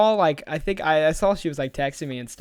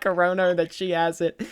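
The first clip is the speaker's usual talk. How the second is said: ordinary talk, nothing marked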